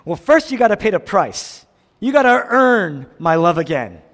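voicing our harsh demand to pay up. Preacher voicing us demanding payment, stern. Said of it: "We tend to say".

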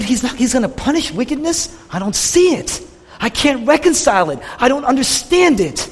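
high, rising protest of bewilderment; confused, conflicted. Retold or imitated. Preacher as Jonah unable to reconcile God's ways, confused. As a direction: struggle aloud with what makes no sense. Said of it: "and he's confused".

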